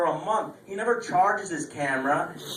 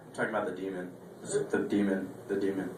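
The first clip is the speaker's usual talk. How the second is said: a low, much softer muttered clarification; low quiet aside; 9 semitones lower, 10 dB quieter, faster than usual. Real, not acted. Comic clarifying the joke to the crowd, muttered low and soft. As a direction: drop the voice and explain under your breath.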